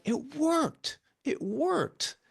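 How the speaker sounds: very high, hushed-excited squeal; amazed delight. Retold or imitated. Husband after the bathroom trick works, amazed delight. Amazed celebration in retold story.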